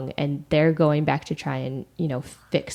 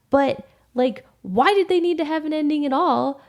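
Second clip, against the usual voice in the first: exasperated rant; 6 semitones higher than usual. Real, not acted. Ranting at the writers.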